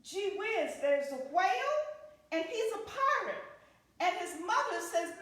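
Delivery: plain reading voice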